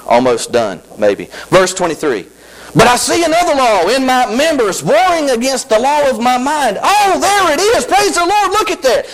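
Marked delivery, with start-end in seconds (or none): 2.7-6.8 high, intoned scripture read. Shown as a demonstration. Preacher reading verse to congregation, high intoned. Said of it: "Look at verse twenty-three". 6.8-9.1 high, drawn-out praise; joyful exclaim. Real, not acted. Preacher rejoicing over verse, high drawn-out. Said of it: "preacher exclaims over verse".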